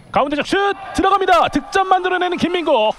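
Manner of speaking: high, loud goal shout; touchdown call. Real, not acted. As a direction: roar the goal, then rattle off the score fast. Live goal call in futsal.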